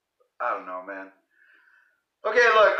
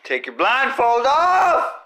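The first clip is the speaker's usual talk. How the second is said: urgent shout; 7 semitones higher, 3 dB louder, slower than usual. Shown as a demonstration. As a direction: yell it out, slower and higher.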